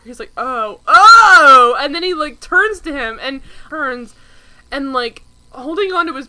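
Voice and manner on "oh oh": loud, imitated cry of realization; mock shocked outburst. Podcaster acting out a TV character's reaction, mock shocked outburst. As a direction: blurt it out big and loud, hammed-up.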